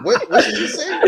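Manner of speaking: high, loud, laughing what did you say; cracking up while asking; laughing disbelief. Retold or imitated. Hearing a classmate's pronoun claim, laughing disbelief. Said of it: "I'm like".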